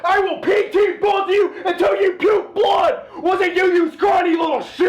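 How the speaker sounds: screaming drill instructor, high and loud; drill sergeant. Acted. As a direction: bark orders at the recruits. Drill sergeant skit screaming at recruits.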